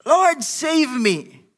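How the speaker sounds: high, very slow desperate cry; crying out for help. Acted. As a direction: drag out a drowning man's plea. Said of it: "He cried out".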